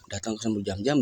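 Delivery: normal talking voice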